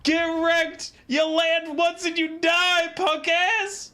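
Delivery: high, loud shoutcaster frenzy; excited shouting. Real, not acted. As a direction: yell it out as the game suddenly ends. Caster exclaims as match suddenly ends.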